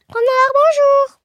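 high, loud call to the fox; salut lancé. Acted. Mouse hails the fox. (salut aigu et sonore)

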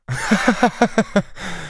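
high, light acted laugh; character laugh. A narrator's reading. Daspry laughing at the stunned Varin, high laugh. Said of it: "Daspry se mit à rire".

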